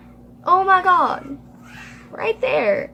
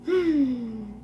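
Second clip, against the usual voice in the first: ordinary delivery, nothing marked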